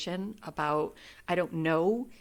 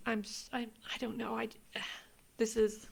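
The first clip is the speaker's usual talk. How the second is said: softer, choked words; crying; 4 semitones higher, 6 dB quieter than usual. Real, not acted. Reader choked up explaining the cards. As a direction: break off in tears. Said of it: "I'm crying".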